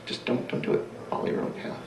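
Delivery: quiet, whispered urging; soft whispered advice. Retold or imitated. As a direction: lean in and hush the advice. [name]'s advice retold.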